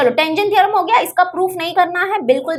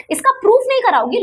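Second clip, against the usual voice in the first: quick, higher whiny student complaint; a pupil objecting, pitched up and fast; mock student protest; 7 semitones higher, faster than usual. Student protesting to teacher, mock whiny complaint.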